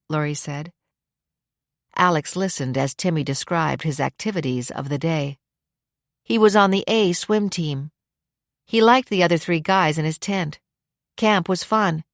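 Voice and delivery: plain reading voice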